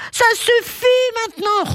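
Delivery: exclamation rugie, très aiguë; lion excédé qui rugit haut perché; rugissement de colère. A narrator's reading. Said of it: "rugit le lion". (rugissement de lion en colère)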